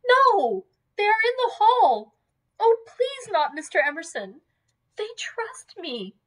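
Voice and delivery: very high, distressed pleading; panicked plea. A narrator's reading. Lucy pleads as others approach.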